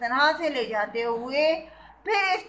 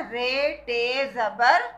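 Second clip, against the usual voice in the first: each letter name drawn out slowly; slow, deliberate spelling; much slower than usual. Shown as a demonstration. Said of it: "अब ये क्या बना?".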